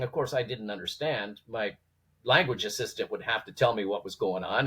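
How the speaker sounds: ordinary delivery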